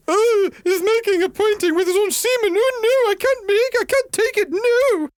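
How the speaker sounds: high, shrill-free mock prude squealing; mock outraged viewer.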